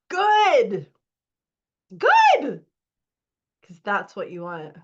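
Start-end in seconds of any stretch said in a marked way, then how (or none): 0.1-0.9 higher, louder cheer of approval; excited praise. Real, not acted. Coach cheering a release. 2.0-2.7 much higher, louder squeal of praise; squealing delight. Real, not acted. Coach cheering a release.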